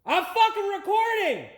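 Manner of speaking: high, furious yell at someone nearby; angry yell. Real, not acted. Yelling at someone interrupting the recording, angry yell.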